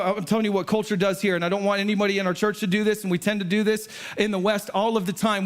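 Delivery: usual speaking voice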